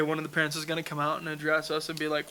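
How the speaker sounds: ordinary talk, nothing marked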